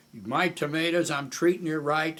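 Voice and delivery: high, loud indignant protest; imitated raving. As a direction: argue it at full voice, like a raving dealer. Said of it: "Sam started raving".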